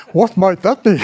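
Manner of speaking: lecturer mode. Shown as a demonstration. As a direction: put the question to the room as a lecturer. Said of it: "I can go back into lecturer mode".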